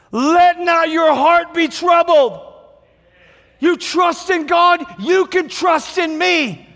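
slow, rising, emphatic sermon; preaching at full force. Acted. Pastor preaching trust to the church, slow and emphatic. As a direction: preach it out to the congregation.

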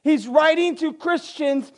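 high-pitched, insistent sermon build; impassioned preaching. Real, not acted. Preacher tells the congregation of Nero's cruelty, preaching with urgent force. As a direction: hammer each atrocity home from the pulpit.

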